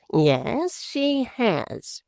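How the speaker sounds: a hint of a gloating husband; smug dwarf. A narrator's reading. Quilp answering for his wife, mildly mocking.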